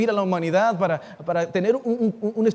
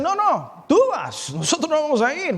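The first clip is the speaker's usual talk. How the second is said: high-pitched, hammed-up objection; protesting refusal; 6 semitones higher than usual. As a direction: push the trip back onto the other person. Acted disciples refusing to go.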